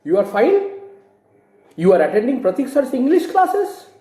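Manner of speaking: "fine" and "classes" lifted high at the end; statement order, rising questioning tune; statement worded as a question. Shown as a demonstration. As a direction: ask it as a statement with the pitch climbing to "classes". Example of wrong question form.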